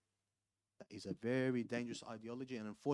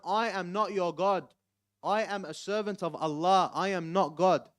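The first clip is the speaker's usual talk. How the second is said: firm loud denial; 5 semitones higher, 11 dB louder than usual. Retold or imitated. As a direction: hammer each word of the denial. Imam Ali firmly rejects worship.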